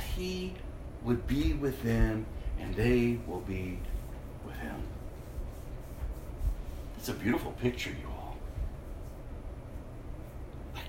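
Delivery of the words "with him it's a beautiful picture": normal talking voice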